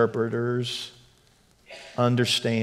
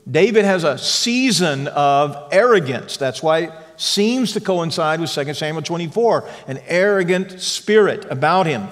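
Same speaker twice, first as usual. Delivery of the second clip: raised, ringing sermon voice; fervent preaching; 5 semitones higher, 5 dB louder than usual. Acted. Pastor preaching hope to his church, fervent and raised.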